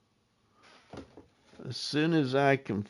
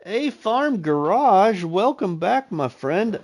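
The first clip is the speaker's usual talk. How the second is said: sing-song announcer; 8 semitones higher, 7 dB louder than usual. Acted. Reading the in-game shop.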